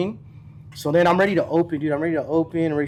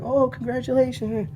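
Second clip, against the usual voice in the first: high, sing-out clerk congratulations; congratulating clerk; 9 semitones higher, slower than usual. Retold or imitated. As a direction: imitate a clerk offering drawn-out congratulations. Retold clerk congratulates him on approval.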